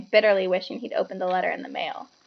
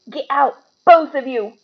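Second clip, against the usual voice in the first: croaked; 5 semitones higher, 7 dB louder than usual. A narrator's reading. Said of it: "croaked Uncle Vernon".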